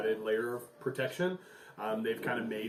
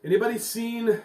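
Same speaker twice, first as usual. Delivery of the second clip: loud, higher call across a crowd; 7 semitones higher, 11 dB louder than usual. Acted. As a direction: shout it out to the whole camp. Mayor calls out to crowd.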